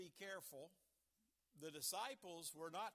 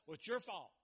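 mock accusation, much louder; acting out a loud accuser; loud blaming; 2 semitones higher, 9 dB louder than usual. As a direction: blurt out the blame. Acted blaming quote in sermon.

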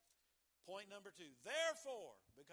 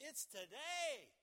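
slow, loud, high today; today hammered out; emphatic today; 6 semitones higher, 7 dB louder, slower than usual. Acted. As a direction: land on today. Preacher climax.

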